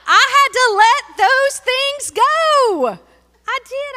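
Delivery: high, loud, slow preacher's cry; soaring pulpit proclamation; preaching climax. Acted. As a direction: lift it up like a testimony shout.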